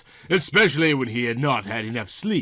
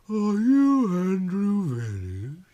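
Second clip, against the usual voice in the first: higher, slow Southern woman voice; Yvonne's voice; 7 semitones higher, slower than usual. A narrator's reading. Yvonne asking the detective his name, Southern and slow. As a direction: play her sultry and unhurried.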